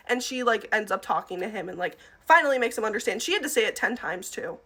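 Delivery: usual speaking voice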